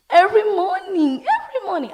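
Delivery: high, loud complaining wail; whining child. Retold or imitated. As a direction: whine it like a sleepy kid. Tired child complains to mom.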